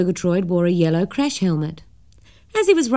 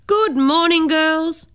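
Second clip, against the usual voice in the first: high, bright and louder hello; cheerily; 10 semitones higher, 3 dB louder, slower than usual. Acted. Mister Murgatroyd cheerily greets the schoolgirls, high and louder. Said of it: "said Mister Murgatroyd cheerily".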